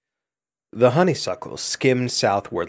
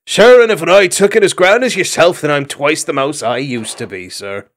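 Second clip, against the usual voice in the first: louder mock Irish lilt; a mouse playfully copying his host's speech; imitating dune hog lilt; 4 semitones higher, 6 dB louder than usual. A narrator's reading. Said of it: "fell into the Dune Hog's speech mode".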